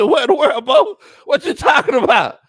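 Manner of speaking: loud, high-pitched incredulous shout; high, loud bewilderment breaking into laughter; baffled outburst. Retold or imitated. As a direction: yell it in comic disbelief. Baffled reply to the cousin, acted.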